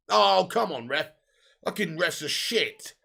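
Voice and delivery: normal talking voice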